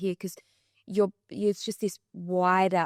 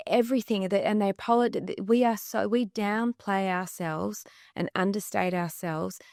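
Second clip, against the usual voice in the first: ordinary talk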